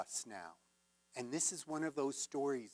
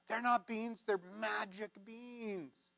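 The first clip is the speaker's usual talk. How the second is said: higher pitched, slowing on magic beans; insistent correction; 6 semitones higher, slower than usual. Acted. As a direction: insist with wide-eyed conviction. Jack insisting to his mom.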